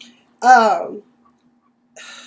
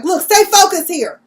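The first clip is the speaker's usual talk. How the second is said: louder, higher snapping order; snapped; 7 semitones higher, 7 dB louder than usual. Retold or imitated. Eric snaps at his siblings to stay focused, louder and sharp. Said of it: "when Eric snapped".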